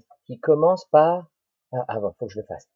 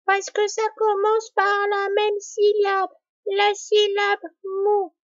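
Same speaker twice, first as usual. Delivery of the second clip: put-on much higher, childlike voice explaining; a little pupil answering mum, very high; child's answer. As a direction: play the child answering the parent. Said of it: "quand papa ou maman vous demanderont".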